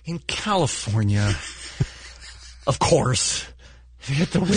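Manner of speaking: slow, scornful newsreader sneer; derisive. Retold or imitated. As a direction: drag it out with contempt. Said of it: "even the derision in his voice".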